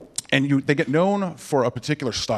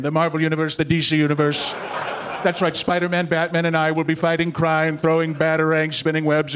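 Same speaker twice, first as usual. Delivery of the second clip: grandiose politician in a steady monotone; escalating boasts, pitch held level; Donald Trump. Acted. Comic mimicking a candidate promising greatness, grandiose monotone. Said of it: "I've always loved watching Donald Trump".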